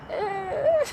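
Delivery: little crying whine; mock whimper. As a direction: pretend to whimper. Whimper after being corrected.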